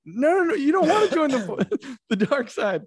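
high, quick, laughing mock panic; hammed-up alarmed Jedi host; panicked pleading. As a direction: plead in a squeaky rush, breaking into laughter. Jedi hosts pleading with girl, retold.

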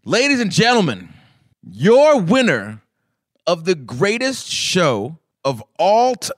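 slow, loud, drawn-out announcing; announcer voice. Shown as a demonstration. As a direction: build it like a game show host. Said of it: "You got the announcer voice".

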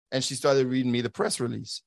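normal talking voice

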